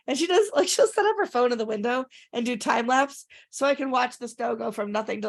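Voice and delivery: ordinary delivery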